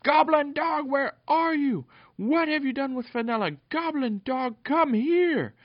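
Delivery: high, loud squeaky gnome yelling for the dog. Acted. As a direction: shriek it out as an angry gnome hunting for his dog. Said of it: "yelling for the goblin dog".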